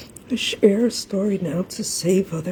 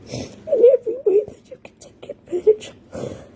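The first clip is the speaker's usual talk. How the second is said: high, breathless whisper through tears, fading out; real crying, falling to a faint whisper; sobbing whisper; 12 semitones higher, 4 dB quieter than usual. Real, not acted. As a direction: force the words out between sobs. Mother tells of daughter's abuse.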